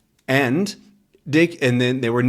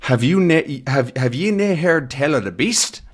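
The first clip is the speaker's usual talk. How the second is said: put-on Scottish accent; 3 semitones higher than usual. Old man's dialogue read aloud.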